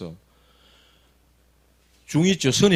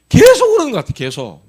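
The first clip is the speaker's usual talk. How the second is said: agitated; 5 semitones higher, 7 dB louder than usual. Retold or imitated. Retelling child's distress.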